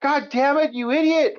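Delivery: high, exasperated god damn it; mock-furious at a dumb partner; quoting a teammate's exasperated yell; exasperated outburst. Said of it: "And Jesse's like".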